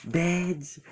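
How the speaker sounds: held-back imitation of an angry yell; mock outrage. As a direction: squeal it like a mad friend. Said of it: "so mad".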